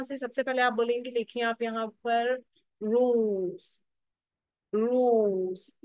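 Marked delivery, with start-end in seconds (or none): 2.8-3.8 रूस with a stretched oo; रूस said slowly on one long, held vowel. Shown as a demonstration. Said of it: "long ऊ का sound आ रहा है". 4.7-5.7 रूस with a stretched oo; रूस said slowly on one long, held vowel. Shown as a demonstration. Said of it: "long ऊ का sound आ रहा है".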